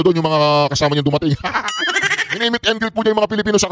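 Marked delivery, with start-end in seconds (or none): 1.4-2.3 mocking laugh. Real, not acted. Laughing at the meet and greet.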